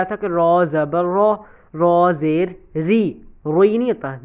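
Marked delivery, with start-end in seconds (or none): 1.7-3.1 ری said slowly and light, thin not heavy; thin ri demonstrated. Shown as a demonstration. Teacher shows students the thin ری sound slowly. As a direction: stretch را زیر ری out, ending on a light thin ری. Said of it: "ری ہوتا ہے".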